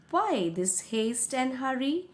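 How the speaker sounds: ordinary delivery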